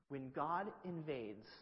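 slow, emphatic sermon cadence; preaching. Acted. Peter preaching to the crowd, slow emphatic preaching. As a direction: lean on "God" and "everything".

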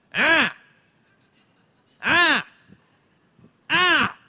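short, loud, high "ah" bursts; imitated baby yell. Baby in high chair before feeding time, imitated yelling. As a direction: yelp like a hungry baby. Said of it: "starting to just yell randomly".

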